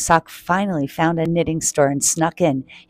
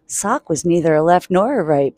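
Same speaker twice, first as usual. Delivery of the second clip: usual speaking voice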